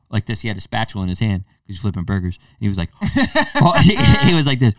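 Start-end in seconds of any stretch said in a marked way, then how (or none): 3.0-4.7 laughing. Real, not acted. Laughing while retelling.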